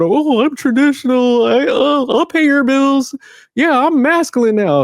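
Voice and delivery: high, slow mocking voice of gullible men; mock them in a high silly voice, slowly; mocking impression. Ranting vlogger mocks men who call themselves traditional, high mock voice. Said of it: "But y'all do steadily be going".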